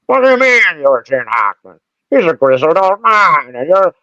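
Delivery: hammed-up exclaiming agency owner. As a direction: protest in a put-on voice. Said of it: "the owner of the agency says to me".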